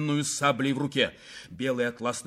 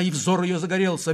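ordinary delivery, nothing marked

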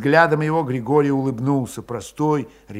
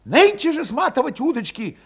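high, loud, pushing everyone to go; heated urging; 11 semitones higher, 3 dB louder than usual. A narrator's reading. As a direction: fire them up, urgent. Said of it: "возбуждающе горячился Валет".

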